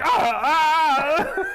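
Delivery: high pained yelps through laughter; imitating a man in pain, laughing; scream of agony. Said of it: "the agony that guy goes through".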